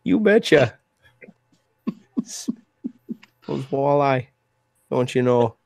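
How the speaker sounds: hammed-up Canadian accent, stretched very slow; mock Canadian accent. Host teases the Canadian guest in a hammed-up Canadian accent. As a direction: tease him in an exaggerated Canadian accent, drawn out. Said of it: "throw a mean Canadian accent".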